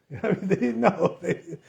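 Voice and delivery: talking through a soft laugh. Real, not acted. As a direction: chuckle as you say it. Amused aside while telling the story.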